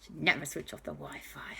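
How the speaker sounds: soft, whispered storyteller's aside; hushed aside. A narrator's reading. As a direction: drop to a disapproving whisper.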